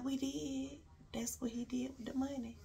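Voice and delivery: hushed, disbelieving whisper. Real, not acted. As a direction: breathe it out like a secret. Stunned reveal of the money.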